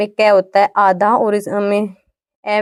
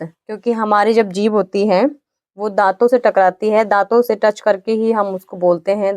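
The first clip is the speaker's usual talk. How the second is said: ordinary talk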